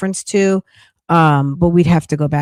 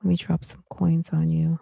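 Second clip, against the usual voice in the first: breathy aside to herself; whispered under her breath; 7 dB quieter than usual. Real, not acted. Whispered aside while reading chat.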